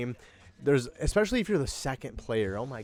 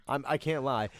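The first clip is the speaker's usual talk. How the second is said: ordinary talk, nothing marked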